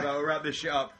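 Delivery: usual speaking voice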